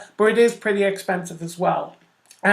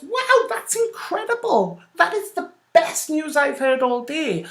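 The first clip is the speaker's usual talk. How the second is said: much higher, gushing mock delight; excited; 7 semitones higher than usual. Artemis North thrilled that Sim Girl wears her clothes, gushing. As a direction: play it as thrilled news. Role-played delighted networking reply.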